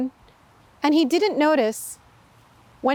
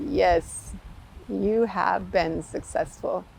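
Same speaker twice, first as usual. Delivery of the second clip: lower, softer and slower, a king smiling; pleased king; 5 semitones lower, 4 dB quieter, slower than usual. Acted. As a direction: let the approval settle softly.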